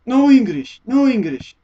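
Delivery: hammed-up, loud Japanese accent; Japanese-accented refusal. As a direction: imitate a worker's insistent refusal in a Japanese accent. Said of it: "And he goes".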